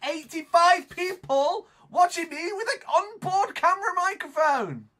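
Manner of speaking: very high, loud outburst; full-voiced hysterical yell; appalled screaming. Real, not acted. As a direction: shriek it in mortified disbelief. Screams in dismay about audience.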